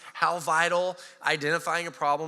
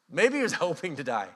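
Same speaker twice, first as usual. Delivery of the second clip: ordinary talk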